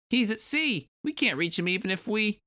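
very high little creature voice; high-pitched tiny henchman explaining; small Kremling. Acted. Kremling telling the bee about the boss, high creature voice.

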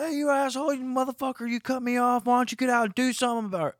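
high-pitched, imitated road rage; angry challenge. Acts out enraged small-car driver.

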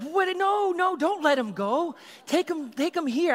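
high loud protest, no, no; alarmed shout. Retold or imitated. Youth group yelling at police to keep him, loud alarmed. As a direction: shout back in disbelief.

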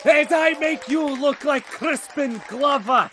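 loud hammed-up wrestler trash talk; wrestling promo shout. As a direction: bellow it like a heel.

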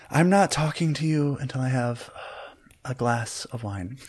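soft, measured, slowing rebuff; icy refusal. Acted. Juliet pouring wine, quietly snubbing Barry. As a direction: refuse quietly, easing off towards the end.

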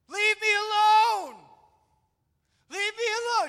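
put-on very loud, high-pitched child's shriek; imitated tantrum screaming. Dad acting out his daughter's tantrum, screaming over and over. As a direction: scream it at full volume like a furious toddler. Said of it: "She just kept screaming that".

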